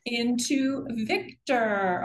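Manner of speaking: ordinary talk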